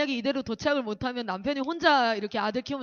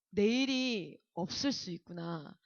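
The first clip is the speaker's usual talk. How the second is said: softer, lower, slow thought to herself; frightened inner thought; 5 semitones lower, 7 dB quieter, slower than usual. Retold or imitated. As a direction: murmur the realisation that tomorrow may not come. Inner thought during plane terror.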